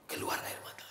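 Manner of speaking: hushed whisper; soft whispered line. Acted. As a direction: breathe it, barely voiced. Hushed moment as patient weeps.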